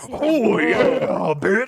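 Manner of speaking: high, gravel-free wrestler promo growl "Oh, yeah"; Macho Man Randy Savage. Retold or imitated. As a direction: ham up the wrestler catchphrase. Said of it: "Now it's Macho Man Randy Savage, dire wolf".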